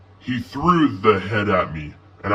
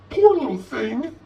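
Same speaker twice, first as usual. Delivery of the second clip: depressed; 4 dB louder than usual. A narrator's reading. Lizzie pitying the dead cat, higher and sad. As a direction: sigh it with pity, higher. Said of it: "in a depressed voice".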